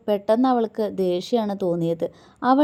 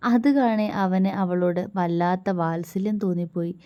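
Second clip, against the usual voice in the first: plain reading voice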